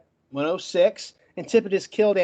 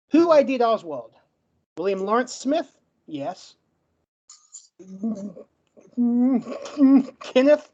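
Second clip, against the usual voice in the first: ordinary talk, nothing marked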